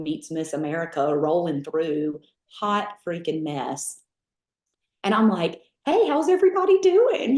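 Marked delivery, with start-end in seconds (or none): 5.9-7.4 high, loud, chirpy hello to the room; bubbly greeting. Retold or imitated. Southern belle greets silent recruits, high and bubbly. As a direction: bounce in like a cheerleader, high and loud.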